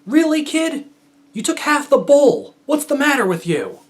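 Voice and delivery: put-on high, loud, exasperated yell at a kid; annoyed shout. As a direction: shout it after a fleeing kid. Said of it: "and shout after me".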